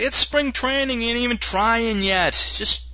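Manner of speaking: high, whiny excuse voice; mock excuse maker. Mocking fans who excuse a slumping player, high and whiny. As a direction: brush it off like a defensive fan.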